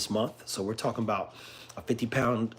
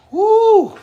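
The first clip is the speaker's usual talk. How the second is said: high loud whoop; excited whoop; 13 dB louder than usual. Real, not acted. Owner whooping at his wild dog, loud and high. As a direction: let out a big whoop.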